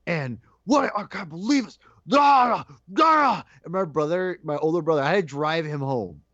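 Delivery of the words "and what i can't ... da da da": high-pitched exasperated sputtering; hammed-up outrage, ending in high cries; irate. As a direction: build from disbelief to wordless mock yells. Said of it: "And I was irate".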